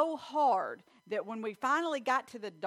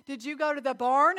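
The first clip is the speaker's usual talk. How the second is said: sharp, louder question from a worried mother; mother's raised, suspicious question; alarmed mom; 5 semitones higher, 9 dB louder than usual. Retold or imitated. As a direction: demand the truth like a nurse mom catching her kid. Mother confronting bleeding child.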